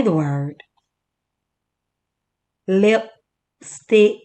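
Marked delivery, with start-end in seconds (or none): 2.7-4.2 "Lipstick" split as lip... stick, higher pitch; syllable by syllable. Shown as a demonstration. Teacher counts chin drops, splitting "Lipstick" into lip and stick. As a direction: break "Lipstick" into two separate syllables. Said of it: "Put your hand under your chin".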